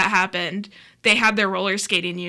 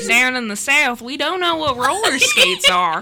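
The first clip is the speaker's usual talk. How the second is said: mock Southern; 5 semitones higher, 5 dB louder than usual. Mocking Southern ignorance, laughing.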